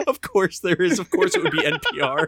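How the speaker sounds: high, laughing exasperation; real amused laughter. Real, not acted. Laughing at the NPR article.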